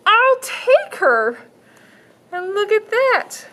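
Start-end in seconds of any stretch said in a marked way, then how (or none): 0.1-1.4 put-on high, slow, firm little girl. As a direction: insist like a stubborn child.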